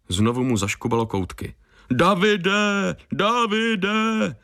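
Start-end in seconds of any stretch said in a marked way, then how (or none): 1.9-4.4 high sing-song mock call of the name; mocking falsetto echo of a worried call; mocking imitation. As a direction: parrot their calling, mocking it high. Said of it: "posměšně napodobil naše volání".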